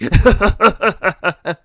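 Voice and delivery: high, very loud gargoyle laugh. Acted. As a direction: howl with laughter. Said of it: "burst out laughing".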